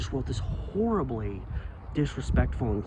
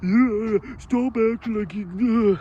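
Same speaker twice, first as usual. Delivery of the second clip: higher, louder, slow sneering commenter voice; mock rude commenter; 8 semitones higher, 3 dB louder, much slower than usual. Vlogger mocking a rude Facebook commenter, sneering. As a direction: sneer it out like the troll. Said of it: "this guy comes like".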